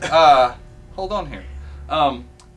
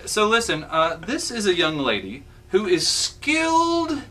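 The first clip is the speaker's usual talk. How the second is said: ordinary talk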